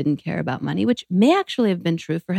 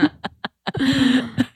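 high real laugh at herself; amused self-mocking laugh; 7 semitones higher than usual. Real, not acted. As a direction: burst out laughing. Laughs at own heroin story.

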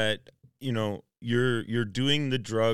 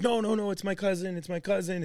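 high pleading protest; 8 semitones higher than usual. Retold or imitated. Pleading excuse in drive-thru.

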